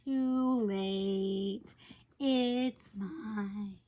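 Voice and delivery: low, slow mock-villain claim; playfully menacing, drawn-out low voice; possessive gloating. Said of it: "but I was like".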